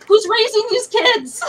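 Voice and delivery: loud, high, slow demand; exasperated outburst. Real, not acted. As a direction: cry out in indignant disbelief. Ranting about children in cinema.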